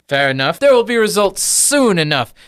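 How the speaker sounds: high, emphatic promise, then officious query; brisk, self-important little academic; fussy gnome professor. Acted. Gnome professor answers his patrons.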